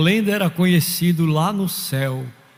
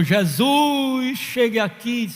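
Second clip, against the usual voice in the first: mild retold cry; 6 semitones higher, slower than usual. Margarida calling Jesus to come, mild retold cry. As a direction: cry out to Jesus with a drawn-out name. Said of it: "Aí Margarida gritou".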